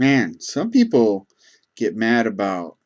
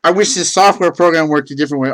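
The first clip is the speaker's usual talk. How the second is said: higher, louder hurried denial; flustered backpedal; 8 semitones higher, 8 dB louder than usual. Retold or imitated. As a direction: rush to take it back. Frantically correcting the misunderstanding.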